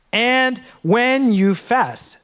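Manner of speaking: And when you fast, each word slow and weighted; slow emphatic reading. Shown as a demonstration. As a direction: lead the congregation slowly, leaning on when. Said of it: "Read these first four words with me".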